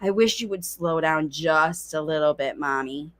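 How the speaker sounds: put-on low, sighing child's plea to mommy; sigh, then plead in a low voice; weary little appeal, pitched low; late talker's plea. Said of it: "it means your late talker says".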